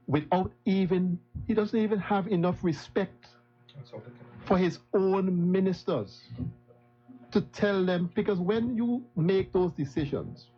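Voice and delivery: rising indignation. Real, not acted. To the House about the Premier squandering money, high impassioned outrage. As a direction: pitch climbs high, impassioned protest. Railing against the Premier's conduct.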